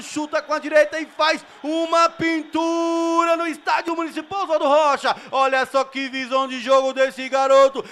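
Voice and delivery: screaming the goal at full pitch; roaring, drawn-out goal call; explosive goal commentary. Real, not acted. Goal scored in amateur match.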